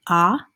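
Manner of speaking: "ah" as an open short O; short O sound. Shown as a demonstration. Teacher introducing the week's short O sound, "ah" sounded alone. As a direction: say "ah" as a separate phonics sound. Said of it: "focusing on short O sound".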